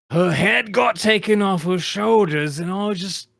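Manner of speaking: high, loud distraught outburst; acted anguish. Drake telling a friend how she died, distraught. Drake recounts a gruesome death.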